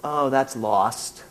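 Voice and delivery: slow, soft, wistful regret; wistful sigh. Retold or imitated. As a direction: let it sink slowly, mourning the past. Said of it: "looking back and thinking".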